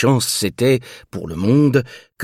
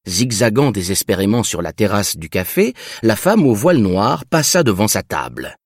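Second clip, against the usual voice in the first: plain reading voice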